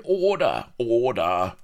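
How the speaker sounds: loud call for order; shouting. A narrator's reading. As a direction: bellow for order. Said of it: "Cries of".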